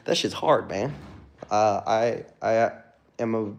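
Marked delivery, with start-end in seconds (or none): none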